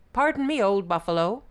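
high, loud hail; calling out politely. A narrator's reading. Brahmin hailing a working buffalo, politely calling. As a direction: call over to get attention.

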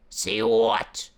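loud, snarling tiger; tiger roar. Acted. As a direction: roar it impatiently. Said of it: "roared the tiger".

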